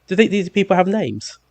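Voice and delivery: lightly voiced wife asking; high mock question, lightly acted; imitating wife's question. Wife's voice retold.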